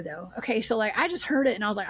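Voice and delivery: ordinary talk, nothing marked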